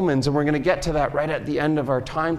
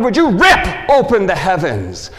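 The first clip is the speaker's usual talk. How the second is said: loud plea; 7 dB louder than usual. Acted. Loud first cry.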